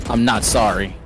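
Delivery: normal talking voice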